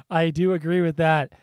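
usual speaking voice

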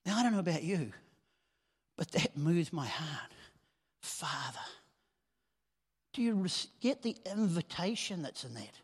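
normal talking voice

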